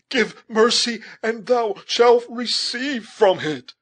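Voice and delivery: high, loud, desperate imploring; horrified plea. A narrator's reading. Imploring youth calling down to the killer, horrified plea. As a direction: beg loudly, voice pitched high in horror. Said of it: "in tones nearly choked by horror".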